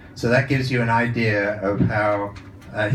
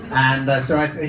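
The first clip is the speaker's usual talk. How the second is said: ordinary talk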